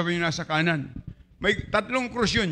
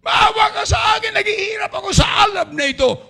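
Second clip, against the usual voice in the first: much higher, loud, desperate pleading from hell; begging; 6 dB louder than usual. Acted. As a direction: beg for mercy in torment. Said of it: "siya'y nagmamakaawa".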